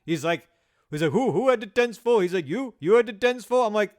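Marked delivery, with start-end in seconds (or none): 1.1-2.2 loud, high-pitched mock Indian accent. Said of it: "imitating his accent". 2.5-3.7 loud, high-pitched mock Indian accent. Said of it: "imitating his accent".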